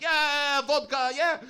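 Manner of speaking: high, rowdy cheer; high-pitched mock whoop from the audience; imitated crowd shout. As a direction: shout out like a merry Russian crowd. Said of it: "shout out, like".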